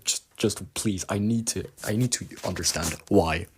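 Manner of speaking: usual speaking voice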